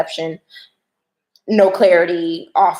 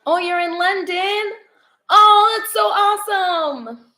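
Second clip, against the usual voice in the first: excited; 10 semitones higher, slower than usual. Real, not acted. Reacting to chat's London news.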